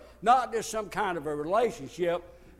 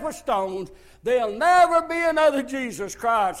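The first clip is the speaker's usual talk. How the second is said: loud, higher, fervent preaching; preaching at full force; 4 semitones higher, 8 dB louder than usual. Real, not acted. Preacher to his congregation, loud and fervent. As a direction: hammer every never home.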